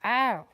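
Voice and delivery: loud ow breaking into laughter; yelp, then crack up laughing; pained yelp then laugh. Real, not acted. Gets pecked, cries out laughing.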